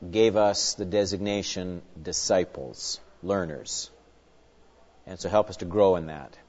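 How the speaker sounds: low, soft, unhurried prayer voice; a pastor softly closing his prayer before the lesson. Acted. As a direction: pray it quietly to Jesus, reverent and steady.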